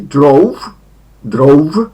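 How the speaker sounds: drove said loud with a gliding O U; English drove. Shown as a demonstration. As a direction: stretch the O U in drove. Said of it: "druga forma jest O U". (głośne drove z dwugłoską ou)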